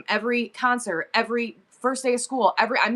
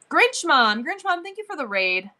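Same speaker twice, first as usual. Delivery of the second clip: high, excited call-out; shout-out; 6 semitones higher than usual. Real, not acted. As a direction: call out the raider in high-pitched thanks. Shouting out the raider in thanks.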